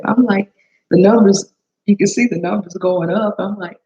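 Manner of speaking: normal talking voice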